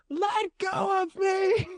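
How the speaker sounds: put-on high, slow, shouted child cry; boy screaming. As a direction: wail it like a grabbed little kid. Niece quoting boy's scream.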